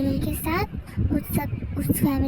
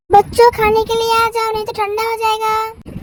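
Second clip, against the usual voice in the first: higher and louder call across the house; 6 semitones higher, 7 dB louder than usual. Acted. Parent calling the children down for dinner, calling out. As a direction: call the kids down to eat.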